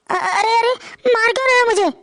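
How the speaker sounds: crying out in pain. Acted. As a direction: cry out, hurt and scared. Husband hits wife. (रोती हुई ऊँची चीख)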